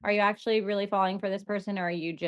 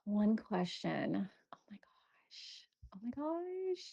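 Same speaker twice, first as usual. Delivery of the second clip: slow, hushed thinking aloud; whispered musing; 9 dB quieter, slower than usual. Real, not acted. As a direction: whisper it to yourself, stumped by the question. Thinking aloud over a hard question.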